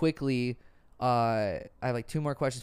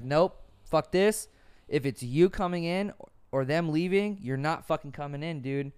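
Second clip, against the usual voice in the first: retold angry refusal; 5 semitones higher, 7 dB louder than usual. Son retelling his mother turning him away from the house, angry. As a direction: lay down the law to him at the door.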